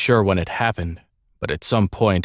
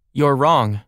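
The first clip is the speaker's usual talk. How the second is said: defiant; 6 semitones higher, 3 dB louder than usual. Acted. Defiant retort to Emperor.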